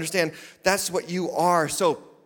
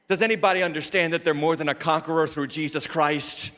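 driving sermon cadence climbing to a high shout; preaching, building to full force; 5 semitones higher, 3 dB louder than usual. Real, not acted. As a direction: build without letup from firm appeal to high cry.

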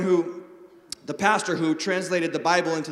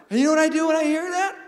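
ordinary talk, nothing marked

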